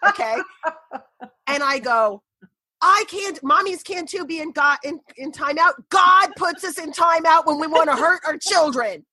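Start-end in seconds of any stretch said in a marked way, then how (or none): 2.8-9.1 imitated frantic mom. Retold frantic argument through the door.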